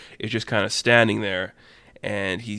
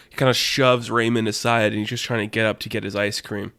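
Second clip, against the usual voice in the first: usual speaking voice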